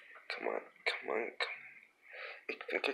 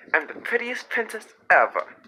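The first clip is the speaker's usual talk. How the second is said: high princess voice; 12 semitones higher, 6 dB louder than usual. Pretend. Princess toy voice.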